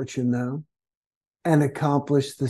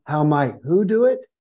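normal talking voice

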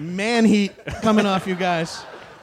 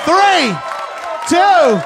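countdown shout; 6 semitones higher than usual. Real, not acted. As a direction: call the count over the crowd. Counting down to match.

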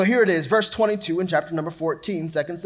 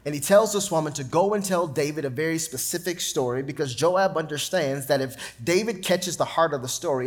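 ordinary talk, nothing marked

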